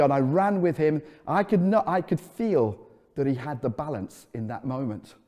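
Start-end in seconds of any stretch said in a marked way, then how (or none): none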